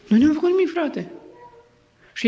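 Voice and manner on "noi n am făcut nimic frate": high-pitched plea of innocence; defensive, voice jumping up; innocent protest. Acted. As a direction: act out the wrongly accused. Accused people plead innocence.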